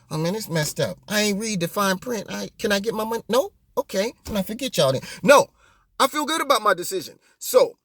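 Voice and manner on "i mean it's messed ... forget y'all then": soft, sheepish complaint; mock buyer's remorse. Host acting out wanting his vote refunded, mock sheepish. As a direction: plead half-heartedly like a let-down customer.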